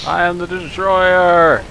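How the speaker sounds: slow, high, booming villain boast; evil destroyer voice. Pretend. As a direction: declare it like a doom-bringing overlord.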